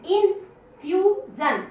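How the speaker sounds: इन्फ्यूजन drawn out syllable by syllable; slow demo reading. Shown as a demonstration. Teacher reading aloud for pupils, very slow. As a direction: sound out इन्फ्यूजन slowly. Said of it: "I N".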